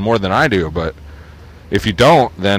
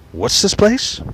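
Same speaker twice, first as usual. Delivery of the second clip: much higher, off-mic question; as if turned away from the phone; faded voice; 11 semitones higher, slower than usual. Acted. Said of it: "his voice faded as he asked someone".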